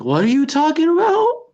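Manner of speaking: high, rising put-on bafflement; mad confused. Coaching a friend to play dumb, mad confused. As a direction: act totally baffled, pitch climbing to the end. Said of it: "just act mad confused".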